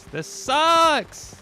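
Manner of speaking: high, loud whining yell; frustrated yell. Real, not acted. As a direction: wail it out in frustration. Time loss, second take yelled.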